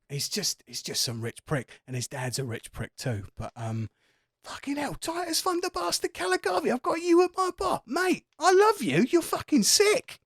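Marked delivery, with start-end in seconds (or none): 4.4-10.2 very high, squeezed excited gushing; starstruck fanboy. Acted. Barman telling Titus he loves him, high-pitched starstruck gushing. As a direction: gush in a high pitch, building louder to the end.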